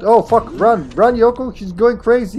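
high, loud burst of alarm; panicked warning. Real, not acted. Player warning Yoko to flee a rampaging zombie, panicked. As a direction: call out urgently to a teammate. Enemy goes crazy, warning teammates.

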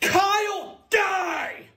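high drawn-out attack scream; battle yell. Acted. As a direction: scream it while charging at him. Attacks his little brother.

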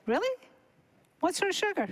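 high-pitched doubting question; acted skepticism. Questions doctor's objection.

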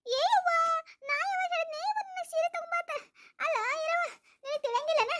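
high, weepy, aggrieved cry; tearful protest. Acted. Daughter-in-law crying to her husband about the saree, high weepy protest.

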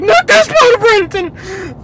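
high, loud, drawn-out mock shriek; imitated hysterical objection; imitating panicked passenger. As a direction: wail in hammed-up alarm. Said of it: "panicked out".

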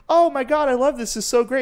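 high-pitched mock gushing; gushing partner. Voices a delighted couple.

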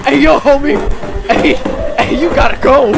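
high, loud heads-up; urgent calling out. Real, not acted. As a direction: yell at him to get moving. Calling warning to video character.

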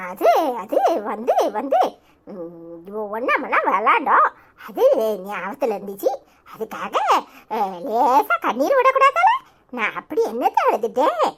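put-on caught-out old woman fumbling for words; flustered grandmother.

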